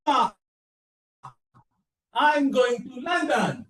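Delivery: hammed-up gleeful shout; a grown man playing an overjoyed kid; bursting to tell mama the news, mock shout; excited call to mama. Acted childish excited call to mama.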